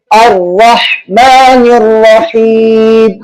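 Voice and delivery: الرحمن الرحیم chanted slowly with long held vowels; slow recitation. Shown as a demonstration. Teacher models the phrase in recitation style. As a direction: recite it like Quran, drawn out. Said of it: "جیسے".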